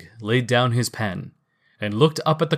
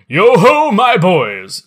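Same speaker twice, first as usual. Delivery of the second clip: put-on loud, hearty jolly old boss; jovial fat voice; 8 semitones higher, 11 dB louder, slower than usual. A narrator's reading. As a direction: call out rich and jovial, slowly. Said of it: "said Fezziwig".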